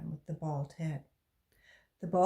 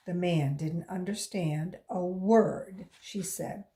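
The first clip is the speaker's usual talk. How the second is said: ordinary delivery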